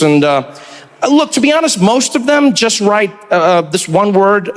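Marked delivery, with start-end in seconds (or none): none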